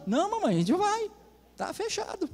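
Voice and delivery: high-pitched, softening mock submission; meekly obedient son. Son yields to his mother, high and meek.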